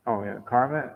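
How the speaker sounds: normal talking voice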